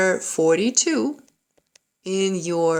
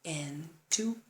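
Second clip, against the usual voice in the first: soft, slow, measured count; 9 dB quieter, slower than usual. Shown as a demonstration. Piano teacher counting in a slow first try, soft and unhurried. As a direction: ease them in with evenly spaced beats. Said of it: "let's try it slowly first".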